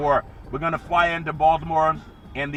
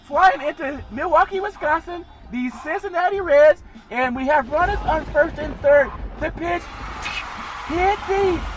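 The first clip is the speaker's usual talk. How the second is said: very high falsetto call getting louder toward the homer; 12 semitones higher, 4 dB louder than usual. Pretend. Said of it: "my best [name] impersonation".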